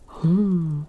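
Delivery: ordinary talk, nothing marked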